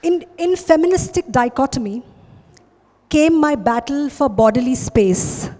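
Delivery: ordinary talk, nothing marked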